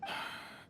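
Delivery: short unvoiced sigh of defeat; exhale, disappointed; brief resigned breath out. Leader sighs at the refusal.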